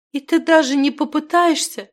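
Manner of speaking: high, loud, pleading question; tearful. A narrator's reading. Girl sinks to her knees before the wizard, tearful. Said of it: "заливалась слезами девушка".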